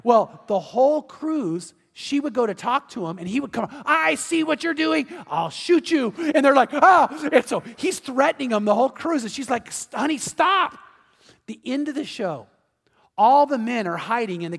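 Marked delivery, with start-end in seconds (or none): 3.8-6.1 higher, slow menacing threat; jealous husband's mock threat. 6.7-7.1 loud, high scared gasp; men yelping in fear; frightened yelp. Retold or imitated. 9.7-10.8 high, pleading stop; wife telling husband to stop; exasperated wife. Retold or imitated.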